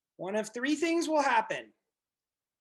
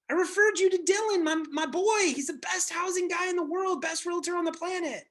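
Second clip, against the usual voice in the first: excited hype; 7 semitones higher than usual. Retold or imitated. Friend hyping Dylan to buyer.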